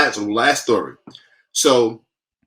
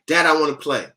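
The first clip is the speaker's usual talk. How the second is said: lightly voiced little boy, louder and higher; eager young son; 5 semitones higher, 7 dB louder than usual. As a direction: pester Dad to play, raised voice. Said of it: "but he comes in the office".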